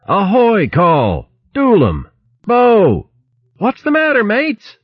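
high-pitched, alarmed hail; yelling. A narrator's reading. Captain yelling to stricken crew ashore, high alarmed hail. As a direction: call each name out high, one by one. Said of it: "Luke yelled".

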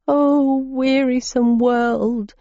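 high, slow sobbing lament. Acted. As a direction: wail it through tears. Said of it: "he sobbed".

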